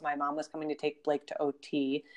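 ordinary talk, nothing marked

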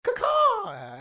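high, squawking crow caw; bird caw. Retold or imitated. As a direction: screech it like a crow. Witch turns into a bird.

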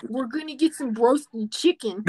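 Pittsburgh Yinzer dinner plans; Yinzer accent. Shown as a demonstration. Amusing the co-host with chicken plans, Yinzer accent. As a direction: put on thick Yinzer vowels. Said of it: "can you do a Yinzer accent".

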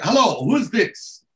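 high, drawn-out phone greeting; Tatiana answering phone. Retold or imitated. Tatiana grabs the phone as Barry calls, imitated high greeting. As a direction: imitate a slow, sing-song pickup, pitched well up.

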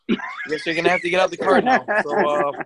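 usual speaking voice

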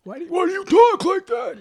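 high-pitched imitation of a needling sibling; mocking brother. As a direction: squeal it in exaggerated mockery. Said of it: "how my brother thinks".

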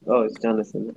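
normal talking voice

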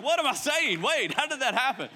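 high, amused double-take; startled at yourself. Retold or imitated. Person surprised at their own sudden enthusiasm, bemused. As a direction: catch yourself, baffled. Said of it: "And you're like".

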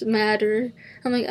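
ordinary talk

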